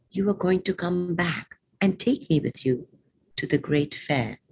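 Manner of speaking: emphatic openings, falling line endings; poem recitation. Acted. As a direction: recite the list item by item, with pauses. Reads poem Great Fair.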